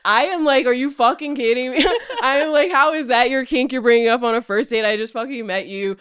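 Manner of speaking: loud, high, laughing through the words; cracking up while telling it; laughing disbelief, loud and high. Real, not acted. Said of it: "I start laughing".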